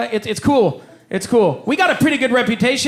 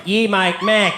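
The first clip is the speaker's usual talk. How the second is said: Aussie "Yeah, mate, Mac", no R; easy Australian confirmation; Australian accent; slower than usual. Retold or imitated. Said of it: "they don't pronounce their R's".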